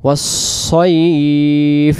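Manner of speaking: ওয়াস-সাইফ with a heavy emphatic সাইফ, drawn out slowly; ওয়াস-সাইফ with a full emphatic sad; correct sad letter. Shown as a demonstration. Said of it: "তাহলে আমরা কি বলবো?".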